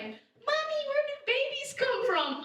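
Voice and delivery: put-on high little-kid voice asking mom. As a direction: pitch way up like a small child asking. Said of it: "when they ask me".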